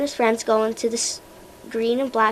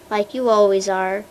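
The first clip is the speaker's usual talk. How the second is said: ordinary delivery, nothing marked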